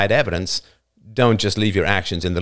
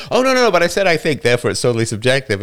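mock defensive excuse; 4 semitones higher, 3 dB louder, faster than usual. Mocking listener's backpedal.

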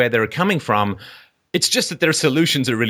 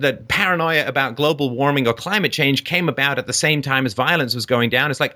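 ordinary delivery

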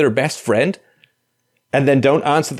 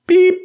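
imitated beep; 5 dB louder than usual. Answering machine beep.

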